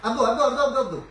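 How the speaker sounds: loud chanting encouragement; coach shouting. Real, not acted. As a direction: shout "abdos" over and over. Coach chanting during push ups.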